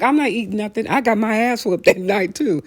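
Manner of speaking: normal talking voice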